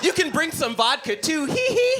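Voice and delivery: put-on high child voice ending in a giggle; sly little kid, giggling at the end; mischievous kid.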